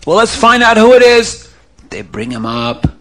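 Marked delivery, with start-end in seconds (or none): 0.0-1.5 high excited shouting at the grave; higher, louder panicked shouts; screaming crowd. Acted. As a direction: yell it out, a crowd that found a body. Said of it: "And people start screaming".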